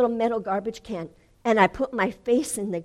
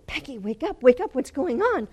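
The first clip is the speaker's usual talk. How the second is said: raised, urgent wake-up call; imitating a startled spouse, building louder; alarmed husband; 5 semitones higher, 7 dB louder than usual. Husband waking her from dream.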